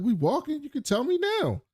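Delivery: high, dismissive imitation of the woman; impatient woman. Woman telling the man to spit it out now, high impatient mimic. Voicing the woman brushing him off.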